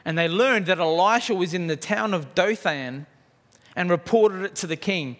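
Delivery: ordinary talk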